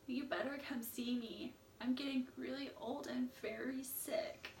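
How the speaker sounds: put-on whispered, frail old voice; weak old mother. Old sick mother calls her son, frail whisper. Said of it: "called up her son and said".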